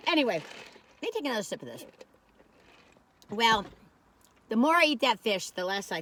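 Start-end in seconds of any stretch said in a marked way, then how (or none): none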